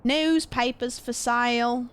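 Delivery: slow newsboy call slipping into Australian; mock British paperboy that comes out Aussie; accidental Aussie accent. Streamer testing the paperboy line, British turned accidental Aussie. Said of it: "now I do 'em on accident".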